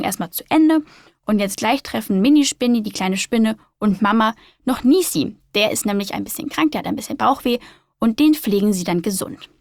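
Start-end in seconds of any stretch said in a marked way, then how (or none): none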